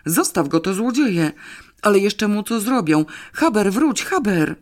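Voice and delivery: high, urgent calling after the dog; alarmed yelling. A narrator's reading. Girl fears thieves may hurt her dog, calling out. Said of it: "wrzasnęła okropnie Janeczka".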